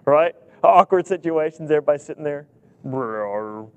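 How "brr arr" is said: stomach growl. Retold or imitated. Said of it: "stomach goes".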